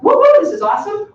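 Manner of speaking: high, fast, loud cheering squeal; excited woo. Retold or imitated. Teacher imagining student thrilled by the lesson, excited squeal. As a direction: burst out like a thrilled kid.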